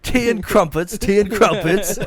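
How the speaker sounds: high, loud, hammed-up posh English accent; mock posh British. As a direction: chirp it like a parody English toff.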